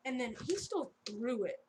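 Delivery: usual speaking voice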